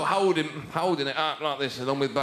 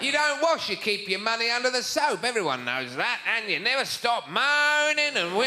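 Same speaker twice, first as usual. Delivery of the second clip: mock Australian jeer; 5 semitones higher than usual. Continues the Australian impression.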